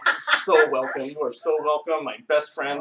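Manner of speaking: loud, effusive gushing; hammed-up delighted host; excited. Four-handed stranger fawning over a new best friend, excited. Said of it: "all excited".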